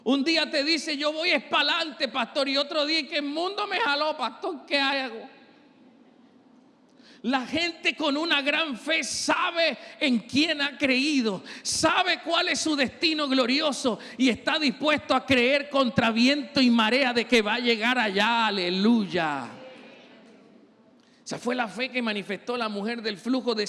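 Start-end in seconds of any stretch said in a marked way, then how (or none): 0.0-5.3 loud, rising preacher's cry; preaching at full force. Acted. 7.2-19.5 loud, rising preacher's cry; preaching at full force. Acted.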